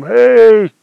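father shouting for his lost son; calling out. Retold or imitated. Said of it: "He'd be calling his name".

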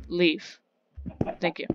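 ordinary talk

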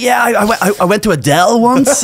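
high, hesitant mock confession; sheepish high voice.

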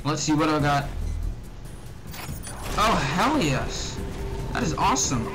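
usual speaking voice

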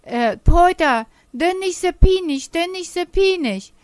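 high, loud frightened cry to Peter; screaming plea. Acted. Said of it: "das schrie". (hoher, lauter, ängstlicher Schrei)

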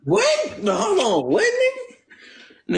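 high-pitched stunned what, stretched slow; shocked disbelief. Real, not acted. Friend hears he got her pregnant, shocked high squeal.

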